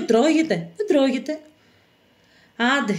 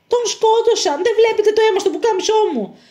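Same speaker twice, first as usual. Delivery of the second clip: high, distraught voice; distressed and pitched high; anguished outburst; 10 semitones higher, 4 dB louder than usual. Acted. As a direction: blurt out the horror of a friend's killing. Fugitive reports his friend killed.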